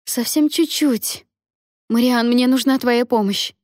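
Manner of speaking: high-pitched cry for help; urgent cry. A narrator's reading. Mary needs Marian's help with the birth, crying out. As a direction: call out high, drawing out the first words. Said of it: "кричала Мэри".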